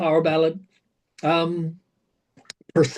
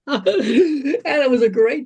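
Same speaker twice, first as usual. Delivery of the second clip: laughing; 7 semitones higher, 3 dB louder than usual. Real, not acted. Laughs while recalling the shot.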